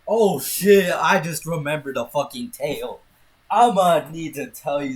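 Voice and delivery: loud, high sudden realization; sudden surprise. Real, not acted. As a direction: blurt it out as it hits you. Suddenly remembers a story.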